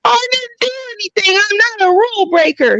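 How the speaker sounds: very high, whiny protest of innocence; acted protest. Answering a Facebook rules notice, high-pitched mock protest. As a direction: plead your innocence in a squeaky pitch. Acts out protest at being accused.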